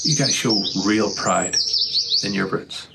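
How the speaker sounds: choked-up sincere pride; emotional. Real, not acted. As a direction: voice catching with real feeling. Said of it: "I don't mean to get emotional".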